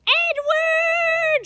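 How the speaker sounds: very high, loud distant shout; loud shout across the sea. A narrator's reading. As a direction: call his name from far away. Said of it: "she shouted".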